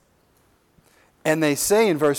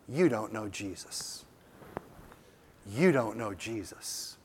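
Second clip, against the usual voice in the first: soft, earnest plea; 6 semitones lower, 13 dB quieter than usual. Acted. Pastor gently tells woman about Jesus.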